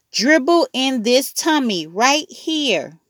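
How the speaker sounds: put-on a small child proudly pointing to his belly; toddler voice. A narrator's reading. A hint of Fudge showing his brother his tummy.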